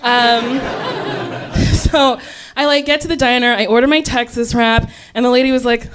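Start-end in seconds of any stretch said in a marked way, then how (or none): none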